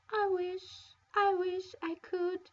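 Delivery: words breaking through tears; weeping. Acted. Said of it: "Cinderella was weeping so hard".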